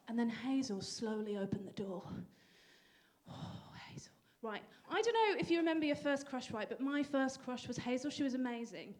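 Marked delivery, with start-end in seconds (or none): 3.3-4.1 soft, breathless sigh of a name; swooning whisper. Acted. Recalling first crush Hazel, whispered with longing. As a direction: melt at the memory of her.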